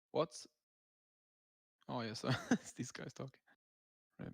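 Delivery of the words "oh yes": normal talking voice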